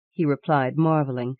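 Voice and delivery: plain reading voice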